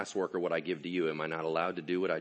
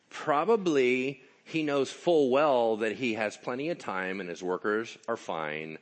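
ordinary talk

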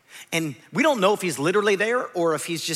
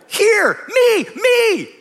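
much higher, louder shout of pick me; eager shout; 11 semitones higher, 6 dB louder, much slower than usual. Acted. Isaiah begging God to choose him, high eager shout. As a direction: wave your hand like a child begging to be picked.

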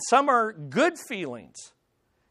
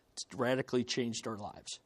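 quiet aside; 7 semitones lower, 9 dB quieter than usual. Real, not acted. Wry aside about smartphones.

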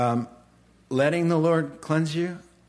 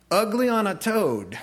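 ordinary delivery

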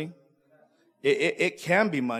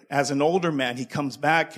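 ordinary talk, nothing marked

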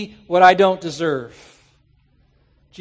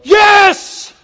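big, much higher and louder cheer; excited shout; 11 semitones higher, 8 dB louder than usual. Retold or imitated. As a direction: whoop like a fan in the stands. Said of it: "When you should be going".

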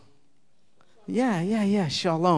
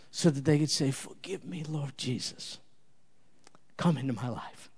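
hushed prayer; 3 semitones lower than usual. Acted.

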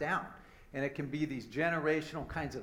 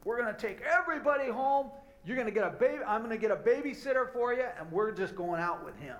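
lightly voiced dad, higher pitch, settling at the end; retold dad; 7 semitones higher than usual. Dad deciding to send everyone home, lightly imitated with raised pitch. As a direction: imitate a dad announcing his decision, pitched up. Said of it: "my dad turns around and he goes".

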